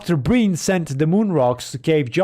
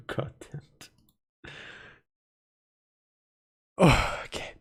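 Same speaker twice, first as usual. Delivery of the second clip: frustrated whisper; 8 dB quieter than usual. Real, not acted. Frustrated at hiccups.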